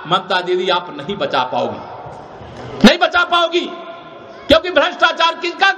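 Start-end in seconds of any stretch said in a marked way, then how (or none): 0.1-2.8 slow, low, trailing-off warning. Real, not acted. Speaker warning the chief minister she cannot save him, low and slow. Warns rival, then repeats it. 2.8-3.7 raised, ringing rally shout; shouted warning. Real, not acted. Speaker telling the chief minister she cannot save him, shouted.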